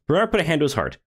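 plain reading voice

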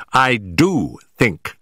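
slow, every word "I do think" weighted; with emphasis. Acted. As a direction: lean hard on "do" in "I do think". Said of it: "said Holmes with emphasis".

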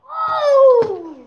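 loud startled "Oh!"; sudden burst of surprise; surprised shout. Real, not acted. Reacting during football play.

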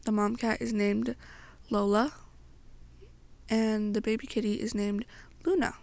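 usual speaking voice

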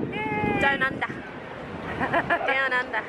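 usual speaking voice

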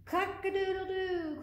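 high, slow storybook crow; rooster crow. A narrator's reading. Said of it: "crowed the rooster".

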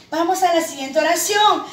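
ordinary talk

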